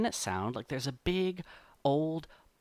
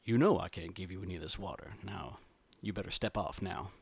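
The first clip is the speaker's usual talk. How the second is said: solemn bear; 5 semitones lower, 4 dB quieter than usual. Acted. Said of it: "he just solemnly shakes his head".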